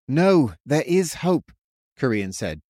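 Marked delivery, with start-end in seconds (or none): 0.1-1.5 slow, firm, hopeful reassurance; comforting. A narrator's reading. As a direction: comfort everyone with conviction. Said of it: "trying to comfort them all".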